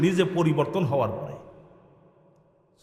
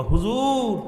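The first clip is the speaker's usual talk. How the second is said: high, drawn-out retold call; 6 semitones higher, much slower than usual. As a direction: stretch the address upward, imitating his appeal. Retold man calls his teacher. (টেনে উঁচু সুরে ডাক)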